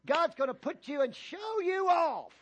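loud, high, slowed shout; preaching shout. Real, not acted. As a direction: drive the promise home. Preacher climaxes the point.

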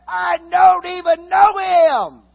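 high, loud, slow denial; panicked denial shout. Acted. Peter denying Jesus to the crowd, shouting. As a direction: shout it to push them away.

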